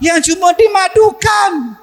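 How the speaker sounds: high, drawn-out cry of a hurt wife; wailing wife. Acted. Preacher voicing a wife who was given a co-wife, high wail.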